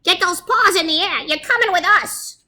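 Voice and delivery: high, squeaky little mouse giving orders; bossy squeaky mouse. Acted. Big Cheese mouse voice continues.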